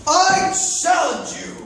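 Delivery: high, slow, drawn-out challenge; a hammed-up royal challenge. Prince calling out Marshall to a duel, high and slow.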